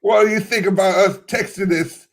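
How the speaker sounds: high-pitched mock British accent; put-on British accent. Imagined staff discussing the tweet, in British accent. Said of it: "got that Scottish accent".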